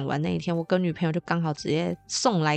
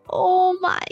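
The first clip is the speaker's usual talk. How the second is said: thrilled, pitched way up, drawn out slowly; amazed; 11 semitones higher, slower than usual. Retold or imitated. First taste of the cookie, gushing high and slow. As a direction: gush over a heavenly bite.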